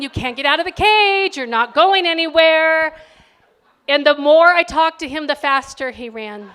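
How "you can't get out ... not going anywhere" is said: louder and higher, yelling into the cage; exasperated yelling. Retold or imitated. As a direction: lose patience with the hamster. Mother confronting hamster at night.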